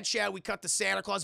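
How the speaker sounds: ordinary talk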